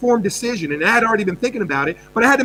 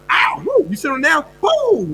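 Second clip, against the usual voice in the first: mock yelp of pain; 7 semitones higher than usual. Acting out sharp pain.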